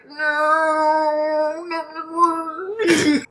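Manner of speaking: high, drawn-out whine of grief; mock wailing. Reader acting out reaction to a widower's return, mock wail. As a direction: wail each no high and long. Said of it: "and I'm like".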